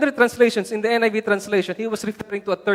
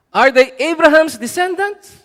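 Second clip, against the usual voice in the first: loud, high rising question; ringing rhetorical challenge; acted challenge; 7 semitones higher, 8 dB louder than usual. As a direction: throw it out to the whole room. Rhetorical challenge from quoted letter.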